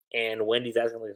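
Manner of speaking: ordinary talk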